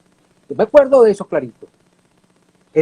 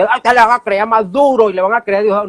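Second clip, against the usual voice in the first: mocking angry citizen; 6 semitones higher than usual. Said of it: "estaba todo arrechote, dice".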